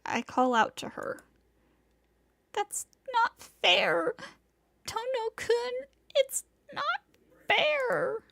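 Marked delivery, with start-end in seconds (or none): 2.5-8.2 high, tearful protest; crying like a spoiled child. Acted. Senpai protesting to Tono-kun, high and tearful. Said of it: "shouts like a spoiled child".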